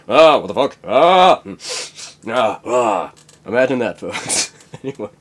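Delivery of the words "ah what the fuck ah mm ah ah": loud grunting mock rant; Hunter S. Thompson. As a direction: growl and mumble it, wasted. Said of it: "also Hunter S. Thompson would be in there".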